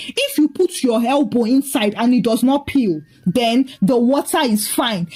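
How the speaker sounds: usual speaking voice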